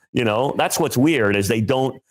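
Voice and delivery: usual speaking voice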